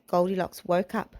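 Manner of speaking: ordinary delivery, nothing marked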